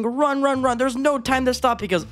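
high-pitched, hurried play panic; panicked urging. Pretend. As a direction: urge a frantic escape, pitched high. Fleeing to the next obstacle.

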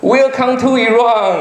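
big, slow, high welcome in English; mock-loud slow welcome to a guest; loud greeting. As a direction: boom it out like a proud host. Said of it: "用很大声的声音说".

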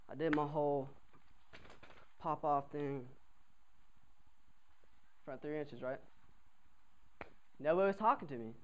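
ordinary talk

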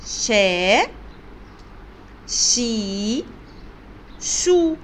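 شا، شي، شو each sounded separately with a long vowel; letter-sound demonstration. Shown as a demonstration. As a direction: model شا، شي، شو one by one, drawn out for learners. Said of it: "دي كده أصوات الشين".

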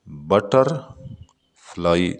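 बटरफ्लाई stretched slowly into three syllables; syllable split. Shown as a demonstration. Teacher showing the class three syllables in बटरफ्लाई, slowly. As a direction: pull बटरफ्लाई apart slowly, bat-er-fly. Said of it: "बटरफ्लाई में तीन syllable".

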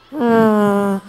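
long, loud fart sound made by mouth; imitated fart noise. Comedian showing how she broke wind, long imitated fart. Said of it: "just break wind".

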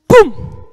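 a sharp, very loud "Pum" blast; loud mock impact. Acting out another lie striking Christina, loud "Pum". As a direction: fire it off like a gunshot.

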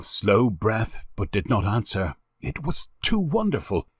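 usual speaking voice

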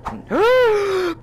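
high, drawn-in shocked gasp; high theatrical gasp; startled gasp. Pretend. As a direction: gasp as if caught by surprise. Startled by a potato.

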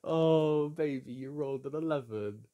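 high, loud, laughing through the words; gleeful laughing. Real, not acted. Telling partner they landed on Mayfair, gleeful. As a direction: gloat with delight.

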